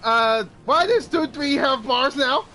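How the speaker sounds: real exasperated yell; alarmed shout. Real, not acted. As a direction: shout it in dismay at the game. Alarmed reaction to game enemies.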